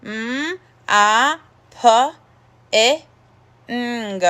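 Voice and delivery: "mopping" split into separate sounds, pauses between; sounding out. Shown as a demonstration. Teacher sounding out "mopping" with the class. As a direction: say each sound of "mopping" alone. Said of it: "let's sound the word out together".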